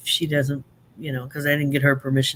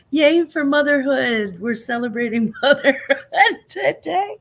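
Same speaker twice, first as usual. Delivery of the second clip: laughing; 9 semitones higher, 3 dB louder than usual. Real, not acted. Ironic toast to motherhood.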